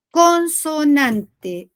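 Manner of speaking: "Consonante" stretched slowly, every syllable held; drawn-out term. Shown as a demonstration. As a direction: spell out "Consonante" slowly for the class. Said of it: "la consonante y la asonante".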